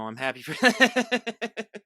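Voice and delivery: slowed, laughing through the words; teasing chuckle mid-sentence. Real, not acted. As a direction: laugh while you say it. Host teasing about carefree life.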